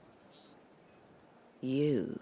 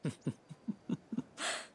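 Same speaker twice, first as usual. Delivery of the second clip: soft knowing laugh; 4 semitones higher, 5 dB quieter than usual. Acted.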